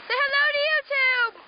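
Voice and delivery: high, loud camera shout-out; calling out. Real, not acted. Kid filming others for YouTube, calling out loudly. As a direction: holler a greeting for the video.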